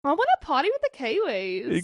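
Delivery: put-on party line in a Kiwi accent. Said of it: "tell me you wanna party".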